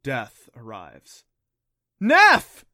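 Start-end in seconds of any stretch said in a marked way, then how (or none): 2.0-2.6 shouting, high and loud; screaming a name. A narrator's reading. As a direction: scream it out in panic. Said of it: "screamed in unison".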